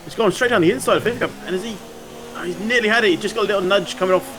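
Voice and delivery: high, fast race call, rising thrill; excited commentary. Real, not acted. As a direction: call the overtake like it's the win. Live overtake call, rising excitement.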